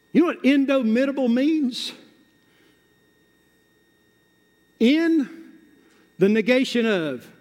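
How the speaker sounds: high, driving sermon delivery; full-force preaching. Real, not acted. Preacher rallying the church to take the offense, forceful preaching.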